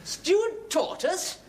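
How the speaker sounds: high, very slow, drawn-out delight; delighted disbelief. Acted. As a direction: pounce on the offer like a treat. Diner delighted by offer.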